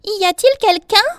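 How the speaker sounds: high, loud calling question. A narrator's reading. Bee calls to whoever is in her bed, high cry. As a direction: call out under the covers. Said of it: "et cria".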